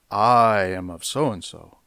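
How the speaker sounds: "I" drawn out slowly, then falling; stress on I. Shown as a demonstration. Preacher mimicking those exalting themselves, long stressed "I". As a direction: stretch the opening "I". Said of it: "the emphasis is on "I".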